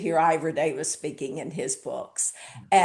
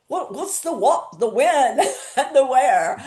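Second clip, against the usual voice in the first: higher, louder, hammed-up Cockney accent; a cheeky Cockney reporter asking the what, when and where, laughing at the end; Ivor Davis Cockney impression; 7 semitones higher, 7 dB louder than usual. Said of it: "I can't do his Cockney accent".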